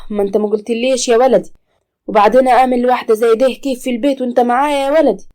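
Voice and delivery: a mother objecting to a stranger at home; loud anxious mother's protest; worried old mother. Acted.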